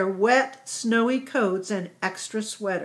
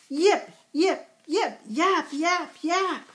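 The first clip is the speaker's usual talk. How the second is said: puppy yips; 7 semitones higher, slower than usual. A narrator's reading. Puppy yipping sounds.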